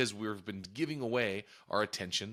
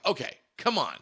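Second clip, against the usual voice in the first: louder, exasperated come on; retold exasperation at the kids, raised and sharp; frustrated; 6 semitones higher, 8 dB louder than usual. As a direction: let the patience run out on it. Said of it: "getting very frustrated".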